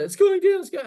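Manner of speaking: high, loud panicked squeal; mock frantic panic. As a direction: squeal it like a maniac watching prices fall. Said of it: "texting me like a maniac".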